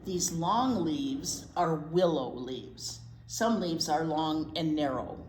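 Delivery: slow, gentle picture-book reading; reading to children. Acted. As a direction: read it aloud for little listeners.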